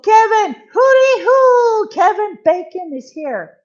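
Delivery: high, loud, sing-song welcome; excited greeting. Real, not acted. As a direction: call out to a friend who just arrived. Greeting Kevin Bacon excitedly.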